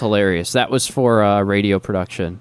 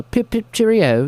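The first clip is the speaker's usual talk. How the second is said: mock British catchphrase, pitched up; British accent; 12 semitones higher, 3 dB louder than usual. Describing the British band, mock British accent. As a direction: chirp it as a cartoon Brit. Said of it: "British guys".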